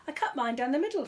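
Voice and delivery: matter-of-fact little bear; young bear explaining eagerly; Paddington voice. A narrator's reading. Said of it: "said Paddington".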